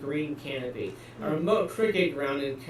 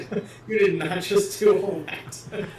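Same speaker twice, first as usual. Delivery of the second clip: laughing; 4 semitones higher, slower than usual. Real, not acted. Laughing at S0's impression.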